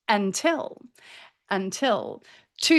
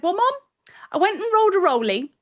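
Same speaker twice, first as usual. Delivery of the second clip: son's voice; 7 semitones higher, faster than usual. Retold or imitated. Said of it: "And he said".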